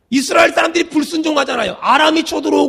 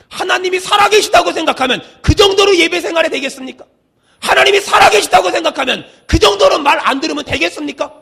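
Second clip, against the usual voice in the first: raised, urgent rhetorical challenge; full-force preaching; 4 semitones higher, 4 dB louder than usual. Real, not acted. Preacher challenging the congregation's obedience, forceful preaching. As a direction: press each question home in a high preaching cry.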